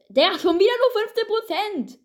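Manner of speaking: high, outraged exclaim. Real, not acted. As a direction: squeal it in disbelief. Outraged at opponent.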